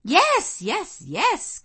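put-on high, loud eager young people; excited cry. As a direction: cheer the idea on eagerly. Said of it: "cried all the juveniles".

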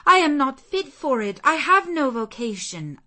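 high, loud pleading refusal; desperate protest. A narrator's reading. Jane refusing St. John's proposal, high and loud. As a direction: push back in alarm. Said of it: "I said".

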